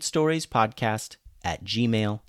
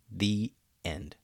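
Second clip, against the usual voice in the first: ordinary delivery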